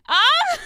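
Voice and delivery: sudden high-pitched shriek; loud scream. Acted. Sudden scream during beach story.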